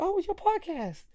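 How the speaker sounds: high, light lady voice; cashier voice. Retold or imitated. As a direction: ask it sweetly. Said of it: "She's like".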